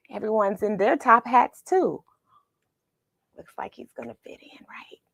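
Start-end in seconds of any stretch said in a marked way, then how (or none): 3.4-5.0 soft unvoiced whisper; whispered aside. A narrator's reading. Narrator whispering to listeners, hushed. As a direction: breathe it like a secret.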